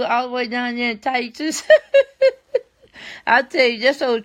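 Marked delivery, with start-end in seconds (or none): 1.7-2.6 loud, high burst of laughter; real high-pitched cackle; amused cackle. Real, not acted. Tickled while greeting a viewer.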